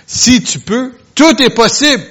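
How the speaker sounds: high, loud rising "Si tu peux"; emphatic declaration. Acted. Preacher voicing Jesus' answer to the father, high and emphatic. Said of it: "Jésus lui dit".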